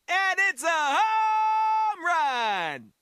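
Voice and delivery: slow, drawn-out loud cry; triumphant yell. Acted. Fighter celebrating a big hit, slow loud yell. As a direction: stretch it out like a stadium announcer.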